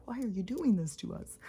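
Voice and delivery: high, pleading dog voice, imitated; upset dog voice. Dog Stella voiced in story.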